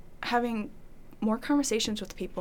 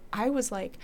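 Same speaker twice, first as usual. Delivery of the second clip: ordinary delivery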